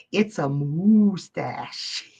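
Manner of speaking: drawn-out moo-stache pun; mooing punchline. Acted. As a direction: stretch "mustache" into a slow, low cow moo. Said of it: "I love anytime I get to make animal noises".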